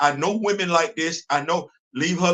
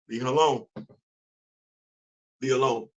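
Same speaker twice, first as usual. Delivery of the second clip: low warning; 8 semitones lower, 5 dB quieter, faster than usual. Real, not acted.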